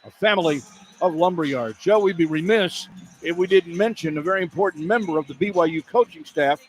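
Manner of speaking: smooth radio ad read; sponsor read. Real, not acted. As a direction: read the sponsor line on air. Sponsor read then notice.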